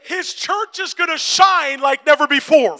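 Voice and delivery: loud, high, drawn-out proclamation; slow, thundering pulpit cry; full-force preaching. Real, not acted. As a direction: shout it slowly to the whole congregation.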